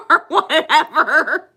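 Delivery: high, loud burst of laughter breaking into the words; real laughter. Real, not acted. Laughing at her own past while telling the viewer. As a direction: laugh it out, stretching whatever slowly.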